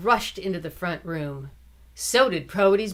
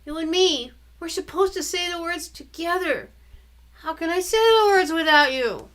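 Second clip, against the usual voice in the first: put-on pleading little boy; 11 semitones higher, 4 dB louder than usual. Brother pleading.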